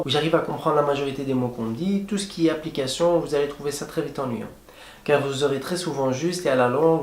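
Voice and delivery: ordinary talk, nothing marked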